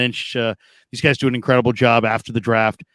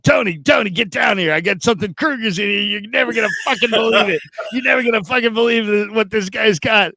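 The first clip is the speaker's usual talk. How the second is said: high, urgent call to come see; excited summons; 7 semitones higher than usual. Retold or imitated. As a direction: imitate someone bursting with news, pitch raised. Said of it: "So he's like".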